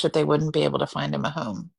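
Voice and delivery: usual speaking voice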